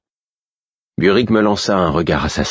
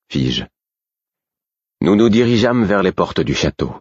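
ordinary delivery

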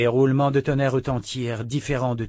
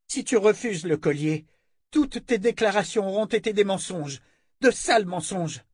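high, hard, demanding voice; commanding woman; 8 semitones higher than usual. A narrator's reading. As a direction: order him about coldly. Callan voiced demanding in story.

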